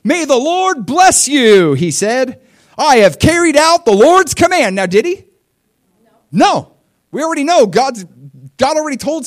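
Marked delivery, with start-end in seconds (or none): 0.0-4.8 high, loud, slow fake-pious greeting; mock cheerful Saul. Pastor mocking Saul greeting the prophet, high syrupy voice. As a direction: ham up Saul's sugary false cheer. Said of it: "He's like".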